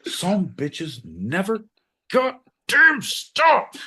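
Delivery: very slow, climbing to a high loud cry; drawn-out rant. Acted. Host rants about those who never stop. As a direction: stretch each word, then blow up.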